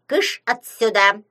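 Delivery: loud, slowed-down command; angry shooing. A narrator's reading. Khlopotun driving the parrot away, loud and cross. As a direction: shoo it off loudly, leaning on each word.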